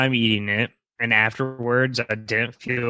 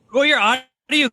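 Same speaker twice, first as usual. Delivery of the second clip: corrupted glitchy audio; 7 semitones higher than usual. Real, not acted. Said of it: "your audio keeps cutting out".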